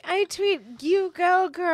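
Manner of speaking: high, bubbly mock cheer; teasing a friend about supporting online; mock cheerleader. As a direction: gush like a cheering fan, pitched high. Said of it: "You're like".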